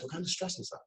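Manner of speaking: high, quiet caring ask; curious question. Shown as a demonstration. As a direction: ask softly with concern. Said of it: "Lazy Jedi questions".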